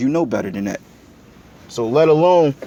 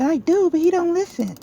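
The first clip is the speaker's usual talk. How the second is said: high falsetto mimic of his girlfriend; mocking girlfriend voice. Man mocking his girlfriend's excuse in a high voice. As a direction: squeak it like a whiny girl. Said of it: "She said".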